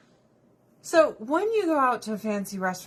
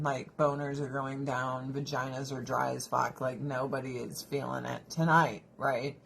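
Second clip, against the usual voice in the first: deadpan; 8 semitones lower, 5 dB quieter than usual. Real, not acted. Deadpan lowered crude joke.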